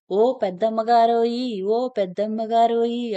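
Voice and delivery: hailing someone far off; calling out. A narrator's reading. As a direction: call out for the mistress from the cattle shed. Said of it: "కేకేశాడు ఈ మీసాలాయన".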